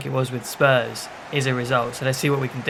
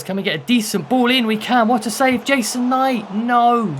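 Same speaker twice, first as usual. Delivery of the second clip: rising, louder, thrilled then crushed; excited near miss; 8 semitones higher, 5 dB louder than usual. Real, not acted. Player cries out as his striker's shot is saved, excited. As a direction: call the chance as it slips away.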